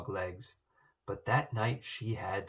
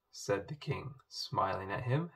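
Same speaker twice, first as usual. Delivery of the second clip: ordinary delivery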